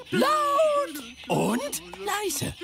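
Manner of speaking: laut und leise, drawn out slowly; slow demo. Shown as a demonstration. Grobi teaching children, slow and drawn out. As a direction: spell out the two words slowly for kids. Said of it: "erkläre ich euch den Unterschied".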